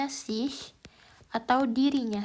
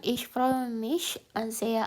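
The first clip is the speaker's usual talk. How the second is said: slow German example; slower than usual. Shown as a demonstration. Reading the answer aloud.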